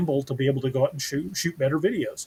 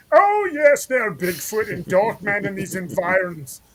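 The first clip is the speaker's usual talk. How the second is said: high-pitched hammed-up professor; put-on museum lecturer, swooping far up in pitch; 6 semitones higher than usual. Shown as a demonstration. Co-host auditions as natural history museum guide, hammed-up high professor voice. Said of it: "need the professor, I'll, like".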